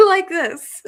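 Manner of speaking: words through a laugh. Real, not acted. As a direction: giggle the words out. Laughing about her curly hair.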